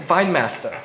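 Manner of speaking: Weinmeister in a German accent, with V for W, VINE-meister; a quick playful German accent on Weinmeister. Shown as a demonstration. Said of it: "I was gonna say it in my German accent".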